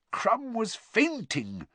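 high, slow moan; drawn-out, high-pitched lament. A narrator's reading. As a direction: drag it out in a high, moaning voice. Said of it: "moaned Mister Weasley".

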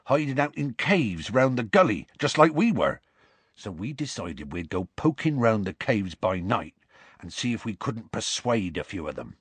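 rustic storyteller, animated then settling; Hagrid continuing. Acted. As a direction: lay out the plan in dialect.

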